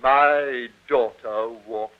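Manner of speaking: slow, low, ominous echo of an insult; quietly outraged; affronted disbelief. Acted. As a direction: repeat it back heavily, word by word. Father echoes insult to his daughter.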